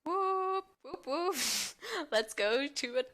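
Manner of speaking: high, loud party whoops with a laugh. Acted. Party girl hyping her friend to hit the town, whooping high. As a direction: whoop it up like girls heading out.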